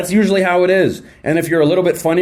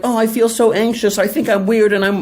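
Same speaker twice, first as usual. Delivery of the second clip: higher, lightly whiny anxious self-talk; anxious person's inner voice, lightly mocked and higher; mock anxious whine; 6 semitones higher than usual. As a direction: play the worrier running himself down.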